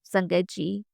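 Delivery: usual speaking voice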